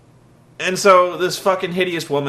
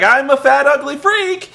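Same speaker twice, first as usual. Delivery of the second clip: high, loud, chirpy and grinning; mock cheerful self-insult; 12 semitones higher, 5 dB louder than usual. Mocking the woman smiling through the insult. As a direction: say it beaming with a big smile. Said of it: "she's like".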